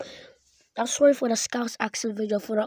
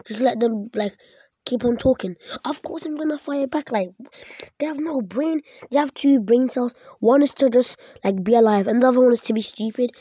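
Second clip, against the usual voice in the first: angry mockery; 7 dB louder than usual. Real, not acted. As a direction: tear into them with loud contempt. Mocking the racists angrily.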